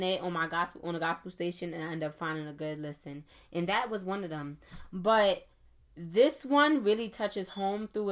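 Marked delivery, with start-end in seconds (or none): none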